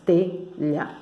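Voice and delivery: TELHA split as te-lha; telha, softly broken after te; syllable by syllable. Shown as a demonstration. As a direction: sound out telha in two separate syllables. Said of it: "telha tem duas sílabas".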